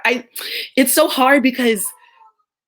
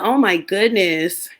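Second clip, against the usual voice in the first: usual speaking voice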